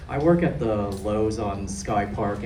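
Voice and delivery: ordinary talk, nothing marked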